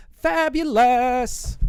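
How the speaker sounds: high, very slow drawn-out Fabulous; hammed-up camp delivery of Fabulous; flamboyant fabulous. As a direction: stretch fabulous out like a theatre diva. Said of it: "Can't even do it".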